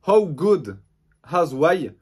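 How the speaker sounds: English title in a clumsy French accent; bad English accent. Real, not acted. Vlogger announcing the next manga title, in a bad English accent. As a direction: say the English words slowly with a poor, French-tinged English accent. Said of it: "Mon accent anglais est nul".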